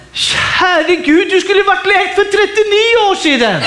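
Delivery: high, exasperated pulpit exhortation; impassioned preaching. Real, not acted. Preacher rebuking believers who cling to old hurts, impassioned. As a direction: scold the excuses with fervour, pitch well up.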